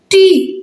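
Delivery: "टी" held as a separate syllable; "टी" drawn out for counting. Shown as a demonstration. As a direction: sound out "टी" on its own, slowly. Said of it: "तीन बन गया".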